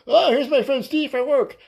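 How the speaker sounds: very high, hammed-up introduction; high mock host voice. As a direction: ham up the husband's welcome. Voicing husband introducing him.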